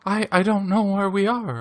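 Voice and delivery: put-on high, breathless scared young wife; high-pitched frightened wife voice; panicked bride. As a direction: play the lost new bride, clinging and frightened. Frightened bride lost in afterlife.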